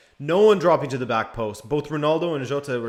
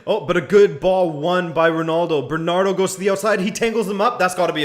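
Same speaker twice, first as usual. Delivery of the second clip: rising, louder play-by-play as the attack builds; excited commentary; 5 semitones higher, 6 dB louder than usual. Real, not acted. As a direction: call the move as it gets dangerous. Live match commentary, attack building.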